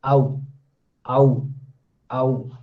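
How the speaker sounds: "ow" isolated, gliding from ah to oo; low, separated "ow" sounds; diphthong demonstration. Shown as a demonstration. Teacher isolating the vowel of house, low glided "ow". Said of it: "And it is house".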